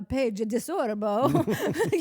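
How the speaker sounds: hammed-up Italian accent, drawn out and loud; mock Italian accent. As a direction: ham up a bad Italian accent, half laughing. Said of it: "I get hired for my accents".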